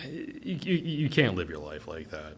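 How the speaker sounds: ordinary talk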